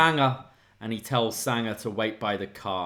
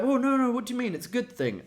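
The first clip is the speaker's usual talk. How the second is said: mimicking Jensen; 11 semitones higher, much faster than usual. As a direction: play the confused guy defending himself. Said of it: "he's like".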